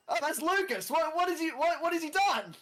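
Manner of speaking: high, excited surprise; startled outcry. Acted. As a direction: exclaim high in alarmed surprise. Player acts startled waking character.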